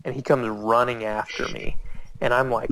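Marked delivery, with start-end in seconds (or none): none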